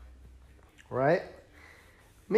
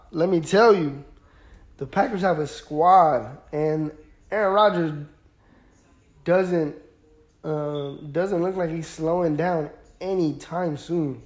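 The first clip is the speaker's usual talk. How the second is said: ordinary talk, nothing marked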